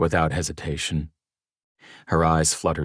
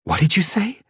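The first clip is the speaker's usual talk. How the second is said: stunned gasp; 5 dB louder than usual. Acted. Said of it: "when she gasped".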